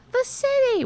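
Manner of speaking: high mocking the city; lightly voiced scolding lady; mock nag. Mimicking the lady citing the city, high mock. Mimicking woman then retorting.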